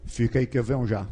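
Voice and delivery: low, scoffing husband brushing it off; dismissive husband. Acted. Husband scoffing at his crying wife, low and dismissive. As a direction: play the tough husband who won't cry, deep and gruff. Husband excuses himself quickly.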